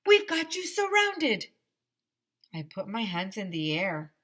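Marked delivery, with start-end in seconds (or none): 0.0-1.5 high, loud megaphone yell; police bullhorn order, way up high and loud; bullhorn shout. A narrator's reading. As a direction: bark it through a bullhorn. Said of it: "a voice shouted through a bullhorn".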